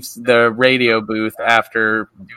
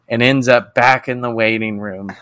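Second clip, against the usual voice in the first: ordinary talk, nothing marked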